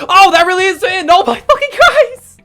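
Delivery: high, loud, laughing shout of disbelief; ecstatic yell. Real, not acted. Player shouting at the final scene, high and laughing. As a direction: let it burst out, half laughing. Realizing the game truly ended.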